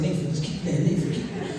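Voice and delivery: whispered plea to keep playing; hushed urging. Urgently hushing friend.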